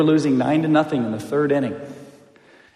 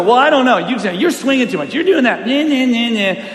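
higher, taunting playground squabble; mocking bickering; 6 semitones higher, 3 dB louder than usual. Teammates ripping on each other, mocking squabble. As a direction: jeer back with sing-song nagging.

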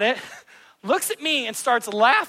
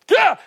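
high, loud mock yelp of shock; hammed-up gasp of horror; Moses' startled cry; 10 semitones higher, 7 dB louder than usual. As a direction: jump back with a yelp. Moses sees leprous hand.

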